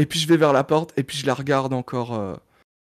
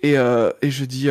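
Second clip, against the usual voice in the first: usual speaking voice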